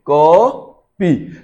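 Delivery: "कॉपी" very slow, first vowel held long; correct long vowel. Shown as a demonstration. Teacher showing real Sanskrit sound, "कॉपी" stretched in two parts. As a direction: split "कॉपी" into a long "को" and separate "पी". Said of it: "तो ये".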